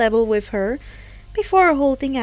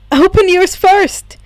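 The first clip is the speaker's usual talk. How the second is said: louder, firm insistence; 5 semitones higher, 7 dB louder than usual. A narrator's reading. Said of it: "told her firmly".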